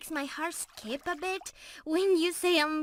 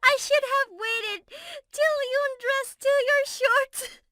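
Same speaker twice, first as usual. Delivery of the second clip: teasing through laughter, higher and louder; laughing tease; 10 semitones higher, 7 dB louder than usual. Real, not acted. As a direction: giggle out the cheeky joke. Teasing while still laughing.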